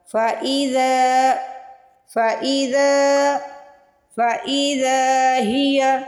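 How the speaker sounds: फ़इज़ा slow with a long drawn ज़ा; stretched zal. Shown as a demonstration. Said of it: "जाल को stretch करेंगे". (ज़ाल को खींच कर)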